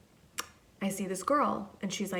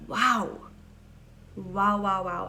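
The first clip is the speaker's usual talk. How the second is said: louder, stacked wows of amazement; awed wow; 6 dB louder, slower than usual. Retold or imitated. Amazed at the kind girl in Malibu, loud wows. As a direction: let each wow spill out in awe.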